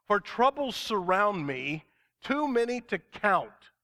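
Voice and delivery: slow, fervent psalm reading; preacher reading a psalm with rising fervor; impassioned scripture reading. Acted. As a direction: pour the psalm out like a plea. Reads psalm aloud in sermon.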